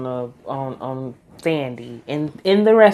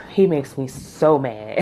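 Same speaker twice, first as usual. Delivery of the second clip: ordinary talk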